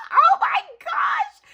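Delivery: high, loud, drawn-out squeal; overjoyed high-pitched exclamation; thrilled. Real, not acted. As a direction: squeal it slowly with delight. Delighted surprise at happy mail.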